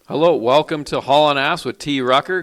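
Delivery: usual speaking voice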